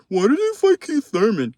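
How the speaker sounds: high, whiny voice of a fan making excuses; sarcastic high fan impression; mocking doubter voice. Mocking Spence fans' excuses.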